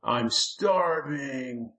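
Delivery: very slow, high whine; whining. A narrator's reading. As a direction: draw out the hungry complaint. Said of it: "the Lurking whined".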